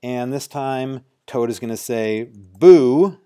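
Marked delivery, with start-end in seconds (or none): 2.2-3.2 loud, playful boo; shouted boo to spook; mock scary boo. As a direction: jump out with a boo. Typing the toad's knock-knock line.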